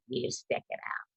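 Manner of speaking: whispered, low witch voice. Retold or imitated. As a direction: hiss it like a witch. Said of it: "In this witch voice".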